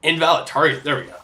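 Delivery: ordinary delivery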